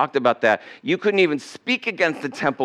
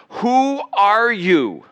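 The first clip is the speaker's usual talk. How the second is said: high, loud, drawn-out WHO, ARE, YOU; indignant challenge; 7 semitones higher, 3 dB louder, much slower than usual. Retold or imitated. Leaders confronting Jesus in the temple, slow indignant challenge.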